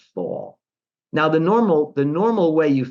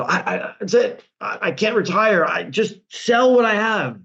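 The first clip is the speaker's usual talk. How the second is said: mock panic; 7 semitones higher than usual. Investor panicking at losses.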